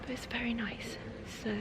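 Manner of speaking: hushed whisper in an echoey cathedral. Real, not acted. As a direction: keep it to a soft whisper. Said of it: "I feel like I have to whisper".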